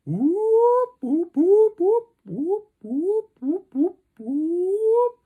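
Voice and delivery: loud, very high rising primate whoops; long drawn-out whoops. Retold or imitated. Teller imitates primate calls from down the trail, loud whoops. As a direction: whoop loudly like an ape, sweeping upward. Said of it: "long drawn out whoops".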